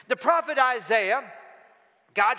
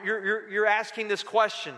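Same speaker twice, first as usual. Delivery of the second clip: ordinary talk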